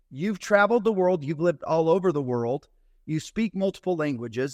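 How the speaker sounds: ordinary talk, nothing marked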